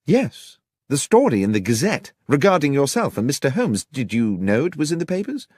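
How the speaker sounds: a lightly voiced journalist friend, agitated; urgent journalist friend. A narrator's reading. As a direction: push the headline at him, eager.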